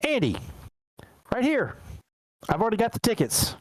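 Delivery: high-pitched voice of the date; acted high-voiced greeting to a date; woman calling her date. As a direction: call him over in a high voice.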